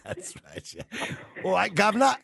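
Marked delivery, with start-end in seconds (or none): 1.4-2.1 high, hammed-up mock Cockney greeting; mock Cockney accent. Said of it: "that was an awful accent too".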